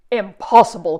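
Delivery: sharp cry of disbelief; cried out. Acted. Farley crying out that laughter can't be gone. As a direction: cry it out in protest. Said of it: "cried Farley".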